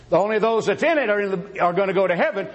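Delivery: ordinary talk, nothing marked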